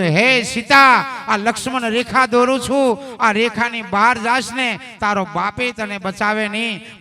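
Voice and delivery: a god drawing the line and warning Sita; Ram commanding Sita. Acted. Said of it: "સીતામાને કીધું તો". (રામની ગંભીર, ઊંચા અને મોટા અવાજે ચેતવણી)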